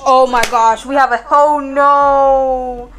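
loud, frantic alarm; scared gamer losing control; panicked. Real, not acted. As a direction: yelp it out in real panic. Panic in the basement.

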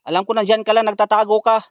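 loud taunting call. Real, not acted. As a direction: shout into the dark at someone hiding. Calling to a hidden ghost.

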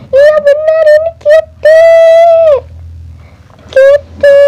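light up at finding the lost cat; excited discovery. Acted. Girl finding her stolen kitten, overjoyed high cry.

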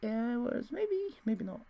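very high falsetto, wavering between yes and no; unsure squeaky voice. Retold or imitated. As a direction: squeak it out like you suddenly doubt yourself. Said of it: "I'm just like".

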